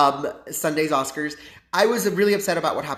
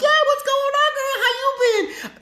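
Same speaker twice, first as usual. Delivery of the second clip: very high, louder sing-song hello; gushing girly greeting; 4 dB louder than usual. Retold or imitated. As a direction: squeal a sweet fake greeting. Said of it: "And I'm like".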